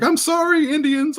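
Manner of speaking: high, put-on silly apology; hammed-up high voice of a friend apologizing; mock Vinny voice. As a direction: squeak it out like a goofy sidekick. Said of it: "And then Vinny's just like".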